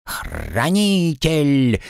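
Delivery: slow chirped parrot cry; bird folk. Acted. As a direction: chirp the word out slowly. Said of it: "внезапно чирикнул Пэт".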